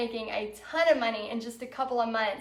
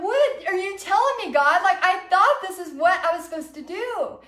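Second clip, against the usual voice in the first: high, loud exasperated plea; frustrated; 6 semitones higher, 4 dB louder than usual. Retold or imitated. Failing vendor questioning God about her purpose, frustrated. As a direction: retell it as a frustrated protest, pitched way up. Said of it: "And I was so frustrated".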